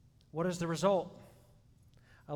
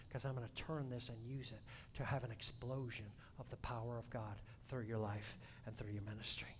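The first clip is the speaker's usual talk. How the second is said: soft, lowered, reassuring; tender voice of Jesus; 3 semitones lower, 8 dB quieter than usual. Pretend. A light Jesus voice soothing the struggling believer. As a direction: comfort the weary gently.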